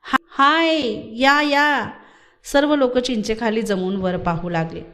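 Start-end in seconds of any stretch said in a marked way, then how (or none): 0.0-1.9 high, excited calling out; sudden shout. A narrator's reading. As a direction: shout for everyone to come. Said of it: "मग एकदम ओरड झाली". (उत्तेजित होऊन उंच आवाजात हाका)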